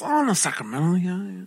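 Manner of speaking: Filipino-accented lady, imitated. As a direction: mimic a Filipino lady chatting. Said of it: "She got the accent still".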